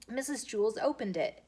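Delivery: plain reading voice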